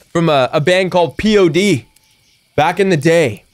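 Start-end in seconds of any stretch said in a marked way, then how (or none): none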